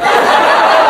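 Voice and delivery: loud breathy laugh at his own joke. Real, not acted. Comedian laughing at his own joke, loud laugh. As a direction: crack up at yourself.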